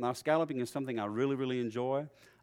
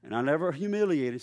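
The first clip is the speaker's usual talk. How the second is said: ordinary talk, nothing marked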